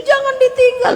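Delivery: high, loud mock wail; imitated desperate plea. Old man begs not to be left, desperate cry. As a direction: burst out high, begging.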